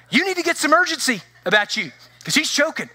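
high, pressing retold demand; mock panic, pitched high; urgent demand. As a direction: insist the nurse hurry up. Demanding urgency from calm nurse.

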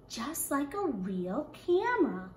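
plain reading voice

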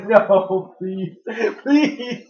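laughing plea. Real, not acted. Dog licks her face.

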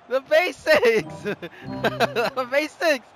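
slow, stretched BASICS; slow, amused drawl on BASICS; drawn-out gloating. Real, not acted. Gloating after showing off.

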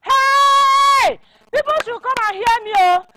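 very high, loud wail to summon people; shrill-pitched, outraged cry for all to hear. Acted. Villager calling people to witness the compound sale, crying out.

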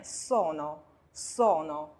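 sono with a hissed s, slowly; voiceless s. Shown as a demonstration. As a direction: model sono slowly, starting on an unvoiced s. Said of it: "斯拼上元音o读so".

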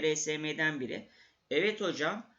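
normal talking voice

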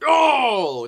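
high, loud "oh"; shocked yell. Real, not acted. Gamer reacting to an undeserved goal, loud cry.

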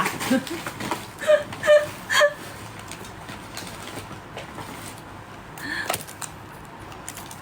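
breathless high silent laughter; long wheezing laugh. Real, not acted. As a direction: laugh until no sound comes out.

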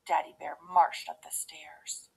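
plain reading voice

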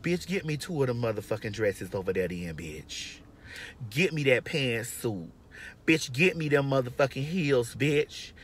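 resigned comedic order. Retold or imitated. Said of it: "I said".

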